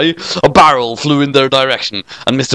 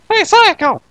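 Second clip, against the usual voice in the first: much higher, bratty schoolgirl call; high girl voice. Acted. Narrator voicing Kyoko calling to Sayaka, high girl voice. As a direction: pitch way up as a cheeky girl.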